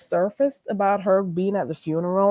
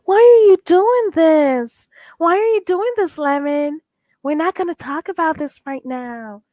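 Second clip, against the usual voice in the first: imitating Krista; 10 semitones higher, 6 dB louder than usual. Mimicking Krista pleading with Lemon, high whiny protest. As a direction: whine it like a flustered woman put on the spot. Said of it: "And she was like".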